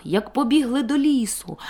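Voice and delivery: plain reading voice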